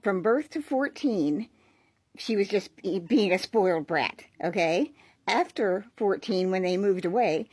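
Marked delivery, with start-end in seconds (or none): none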